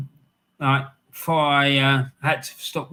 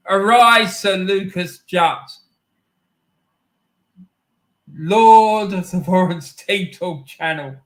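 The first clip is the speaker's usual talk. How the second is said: solemn regal knighting; 5 semitones higher, 6 dB louder than usual. Pretend. Mock knighting of a player.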